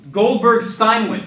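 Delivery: "Goldberg Steinwitz" slow and loud, each syllable placed; careful pronunciation. Shown as a demonstration. Host carefully announcing guest's name, slowly. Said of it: "let me get this name right".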